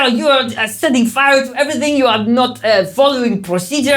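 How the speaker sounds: put-on high, loud French chef accent. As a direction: shout it as a flustered French chef. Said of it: "I've written down is French".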